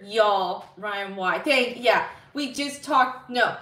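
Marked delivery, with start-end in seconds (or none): none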